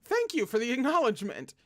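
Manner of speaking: loud, high flustered protest; flustered outburst. Real, not acted. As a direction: blurt it out, embarrassed. Flustered loud reaction to text.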